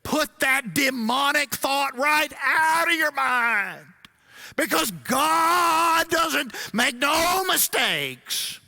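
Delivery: put-on drawn-out hollering Southern preacher accent; pastor's Southern preacher accent. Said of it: "I'm gonna try to do his accent".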